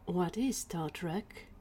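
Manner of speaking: slow, soft, whispery voice; small whispered question. Acted. Tiny Gwen asks Ali about Star Trek, slow whisper. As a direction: whisper it slowly as a private question.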